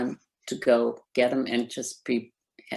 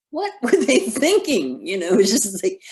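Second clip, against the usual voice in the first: laughing disbelief; 7 semitones higher, 5 dB louder than usual. Real, not acted. Disbelief at huge bills.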